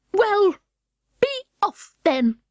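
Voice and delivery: high, slow sulky pigeon. Acted. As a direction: grumble it off like a huffy bird. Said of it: "in a sulky tone".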